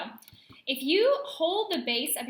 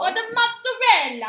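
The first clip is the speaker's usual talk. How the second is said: loud hammed-up Italian accent, high; terrible Italian accent; 10 semitones higher, 15 dB louder than usual. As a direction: shout it like a cartoon Italian chef. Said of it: "You have a terrible Italian accent that you very much enjoy using".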